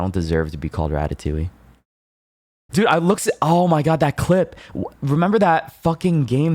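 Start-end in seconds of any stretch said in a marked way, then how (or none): 2.7-6.5 excited. Real, not acted. Recalls funny clip.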